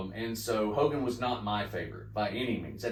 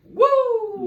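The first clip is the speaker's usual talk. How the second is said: loud, much higher held "Woo!"; Ric Flair woo; 12 dB louder than usual. Retold or imitated. Imitating the chop that gets the crowd's signature howl, a loud high woo. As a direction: let out the wrestler's signature howl.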